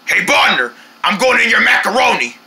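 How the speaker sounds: ordinary talk, nothing marked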